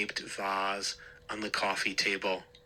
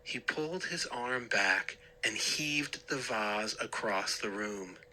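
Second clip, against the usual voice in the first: usual speaking voice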